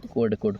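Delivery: कोड said as "kod", the l left silent; could with silent l. Shown as a demonstration. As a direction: say कोड with no l sound, just k-o-d. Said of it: "एल silent रहता है इसमें".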